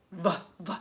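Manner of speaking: short "vuh, vuh" for "V"; letter sound. Shown as a demonstration. Teacher showing children what visit begins with, "V" as "vuh". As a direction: say the sound of "V", not its name, twice.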